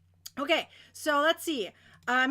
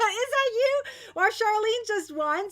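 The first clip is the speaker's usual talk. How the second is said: excited; 6 semitones higher, 4 dB louder than usual. Real, not acted. Excited calling out to viewer.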